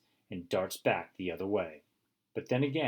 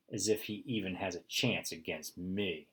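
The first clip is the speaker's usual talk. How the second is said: ordinary delivery, nothing marked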